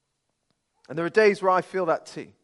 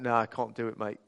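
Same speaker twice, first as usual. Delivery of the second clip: lightly put-on London accent, lower-pitched; 5 semitones lower, 5 dB quieter than usual. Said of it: "why do they always speak in that accent?".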